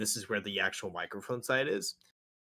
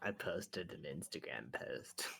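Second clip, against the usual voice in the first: slow, breathy whisper; 7 semitones lower, 12 dB quieter, slower than usual. Real, not acted. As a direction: whisper it slowly. Whispered line.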